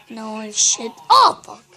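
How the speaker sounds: ordinary talk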